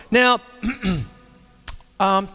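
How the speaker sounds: ordinary delivery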